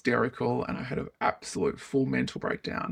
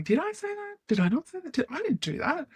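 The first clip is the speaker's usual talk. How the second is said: much higher, doubting questions; confused self-doubt; 14 semitones higher than usual. Retold or imitated. A woman questioning her own memory, pitched far up and unsure. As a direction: voice the gaslit confusion.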